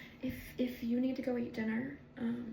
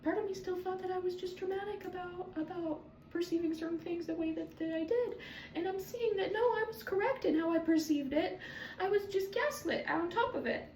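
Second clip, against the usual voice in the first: higher, wavering emotional voice; choked-up realization; near tears; 8 semitones higher, 3 dB quieter than usual. Real, not acted. Vlogger on being gaslit, emotional and higher.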